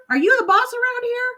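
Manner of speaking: very high squawky bird voice, taunting; cheeky parrot mocking the mother; high parrot jeer; yelling parrot. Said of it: "blinking and yelling".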